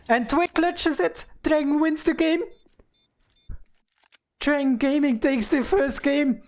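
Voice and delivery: high, loud triumphant match call; esports caster. Real, not acted. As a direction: shout the clutch as it lands. Caster calls the winning clutch.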